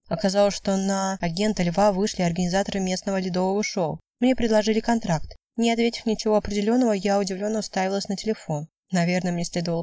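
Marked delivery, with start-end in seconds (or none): none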